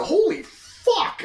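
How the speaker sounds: slow, high-pitched stunned curse; mock shock, dragged out; stunned swearing. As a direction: stretch out an awestruck expletive. Acting out the panel's exclamation.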